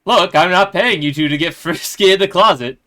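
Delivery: loud, high scolding teacher; Mister Latikin. Acted. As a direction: scold them like a strict teacher. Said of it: "Mister Latikin".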